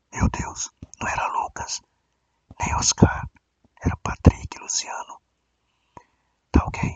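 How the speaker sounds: hushed conspiratorial whisper. Acted. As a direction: lean in and whisper it. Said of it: "Eu vou falar baixinho".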